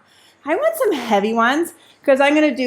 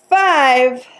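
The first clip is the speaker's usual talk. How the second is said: ordinary delivery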